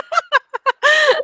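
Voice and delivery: a high laugh that tumbles down; high burst of laughter. Real, not acted. Laughs at the listicle item.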